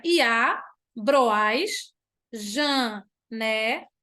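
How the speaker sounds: syllables stretched for scansion; slow verse reading. Shown as a demonstration. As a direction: drag the line out to count it. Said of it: "Eu junto com as aqui". (leitura lenta para escansão)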